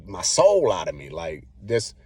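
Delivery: ordinary talk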